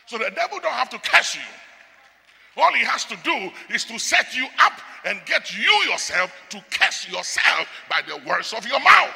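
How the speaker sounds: loud, ringing pulpit warning; full-voiced sermon delivery; preaching at full force. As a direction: thunder it at the congregation like a preacher. Preaching, higher and louder.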